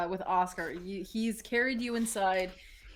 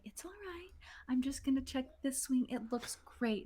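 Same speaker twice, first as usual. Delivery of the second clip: soft, whispery soothing; gentle murmur; 6 semitones higher, 6 dB quieter than usual. Acted. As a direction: coo softly to calm a hurt bird. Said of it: "murmuring to you super low and gentle".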